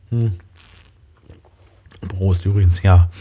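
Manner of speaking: right after a sip; talking around a drink; while sipping a drink. Real, not acted. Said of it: "Jetzt muss ich erstmal einen Schluck trinken". (nach einem Schluck)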